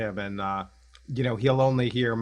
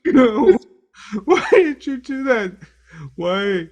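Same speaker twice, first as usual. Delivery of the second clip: high, loud hammed-up wail; fake-wounded high cry; mock wail; 10 semitones higher, 9 dB louder than usual. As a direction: keep up the pretend crying. Mock hurt after being raged at.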